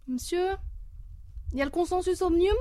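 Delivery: lightly voiced pupil trying his luck, a bit fast; schoolboy voice.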